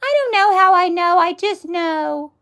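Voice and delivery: high, slow, whiny mock of someone who doesn't know; high-pitched mock whine; mocking clueless voice. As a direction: squeak it out like a baffled student. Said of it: "if you're just like".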